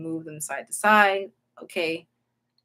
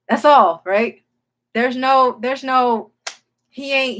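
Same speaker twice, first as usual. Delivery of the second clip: loud and pressing; insisting hard there's nothing more to it; emphatic loud denial; 5 semitones higher, 10 dB louder, slower than usual. Real, not acted. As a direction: drive the point home loudly.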